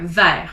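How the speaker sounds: "vert" cut off with no T, like vair; silent T. Shown as a demonstration. Teacher showing the silent T in "vert". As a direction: stop "vert" dead before the T. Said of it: "it stops there".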